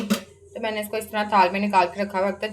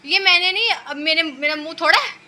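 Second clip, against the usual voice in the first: high, loud protest, denying it; indignant protest; 7 semitones higher, 5 dB louder than usual. Real, not acted. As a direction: push back at the teasing, much higher. Husband mocks her tea. (पति के ताने पर ऊँची आवाज़ में नाराज़गी भरा विरोध)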